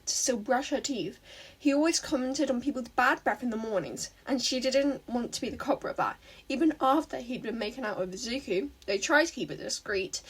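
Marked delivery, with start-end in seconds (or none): none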